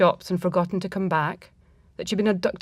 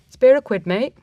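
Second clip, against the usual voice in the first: higher, louder call for spare change; a street beggar calling out, higher and louder; homeless man calling out; 6 semitones higher, 7 dB louder than usual. Acted.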